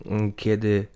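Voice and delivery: ordinary talk, nothing marked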